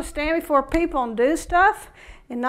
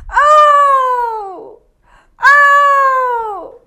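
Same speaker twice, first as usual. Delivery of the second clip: mock wail; 10 semitones higher, 12 dB louder than usual. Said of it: "did he say".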